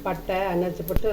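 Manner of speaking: usual speaking voice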